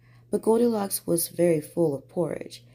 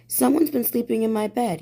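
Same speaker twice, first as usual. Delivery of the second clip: higher, cross grumble of a father bear; a mock-gruff bear complaint, pitched up; grumbled; 5 semitones higher, 4 dB louder than usual. Daddy Bear finds his bed rumpled, higher cross grumble. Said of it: "he grumbled".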